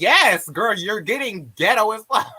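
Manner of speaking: high, loud sassy comeback; sassy retort. Retold or imitated. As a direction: fire it back with attitude. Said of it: "I was like".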